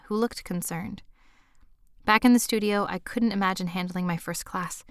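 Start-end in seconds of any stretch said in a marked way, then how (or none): none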